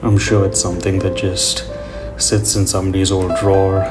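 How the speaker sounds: ordinary talk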